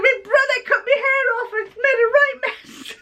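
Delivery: high, half-laughing, half-tearful complaint; sulky daughter. Retold or imitated. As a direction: blurt it out laughing through tears. Daughter explains to teacher.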